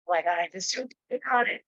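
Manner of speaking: ordinary talk, nothing marked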